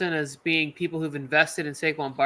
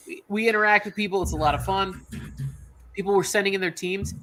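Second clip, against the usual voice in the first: ordinary talk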